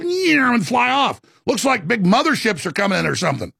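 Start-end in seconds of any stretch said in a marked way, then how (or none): 0.0-0.5 much higher quick swoosh as the orbs fly off; imitated orb whoosh. Retelling fireballs bursting into little things, higher zipping whoosh. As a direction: toss off a bright vocal zip for the little things.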